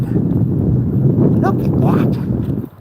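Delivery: high, airy doggy talk; cooing to dog. Real, not acted. Walker greeting a big dog, high cooing.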